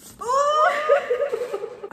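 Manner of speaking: high loud squealing laugh near tears; real delighted squeal; shrieking laugh. Real, not acted. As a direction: burst into an overjoyed shriek of laughter. Overjoyed at gift.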